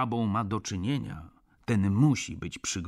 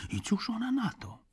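whispered, voiceless and higher; hushed question; 13 semitones higher, 4 dB quieter than usual. A narrator's reading. Narrator pressing the doctor for gossip, whispered.

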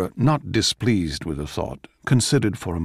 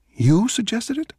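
surprised. Acted. Mozart surprised by Salieri.

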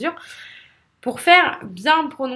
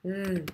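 softer drawn-out buzzing like a Z; voiced th buzz; 3 semitones lower, 9 dB quieter than usual. Shown as a demonstration. Teacher isolating the vibrating th, a held buzz. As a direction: hold a buzz on the tongue tip. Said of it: "C'est comme un Z".

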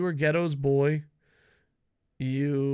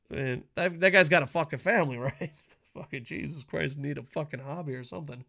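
ordinary delivery

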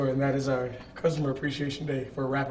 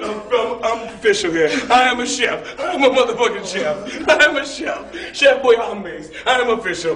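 loud, high, proud boasting; hyped-up chef brag; 7 semitones higher, 9 dB louder than usual. Acted. Chef bragging to the crew, loud and high. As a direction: shout it like the proudest chef alive.

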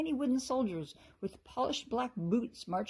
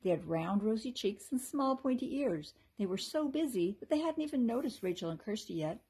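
ordinary delivery

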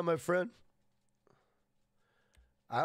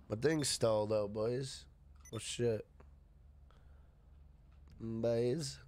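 chewing; 4 semitones lower, 3 dB quieter, slower than usual. Real, not acted. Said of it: "Jack, what are you eating now? I heard, uh, crunchy noise".

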